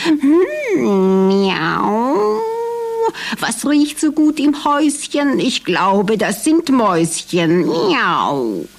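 a cat meowing its words; curious tomcat on the hunt. Acted. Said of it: "sprach der Kater noch einmal".

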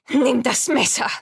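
loud, high urgent order; loud command. Acted. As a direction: snap the order out. Laboring woman orders the cut.

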